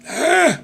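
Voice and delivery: put-on high startled old man ah; old man gasp. Grandpa's reaction.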